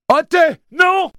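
very high, loud, urgent yell; crying out. A narrator's reading. Jake trying to stop Oté from leaping, screamed. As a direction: scream a desperate warning. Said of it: "cria-t-il".

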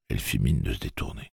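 ordinary delivery

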